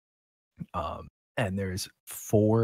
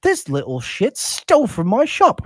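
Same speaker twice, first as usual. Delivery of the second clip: put-on outraged old shopkeeper; 10 semitones higher, 8 dB louder than usual. Shopkeeper accusing a kid of theft, high and outraged. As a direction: stretch out the high, indignant complaint.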